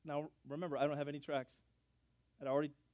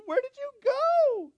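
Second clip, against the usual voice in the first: very high, loud, drawn-out squeal; piercing cartoon-high impression; excitable receptionist; 13 dB louder, slower than usual. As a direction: mimic her stretched-out mousy wail. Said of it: "she talks like this all the time".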